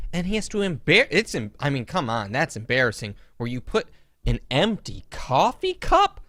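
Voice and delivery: ordinary talk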